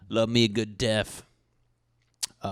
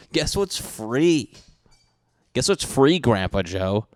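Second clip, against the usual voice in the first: mocking scold; 3 semitones higher, 4 dB louder, slower than usual. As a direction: taunt him like a child. Scolding a sloppy eater.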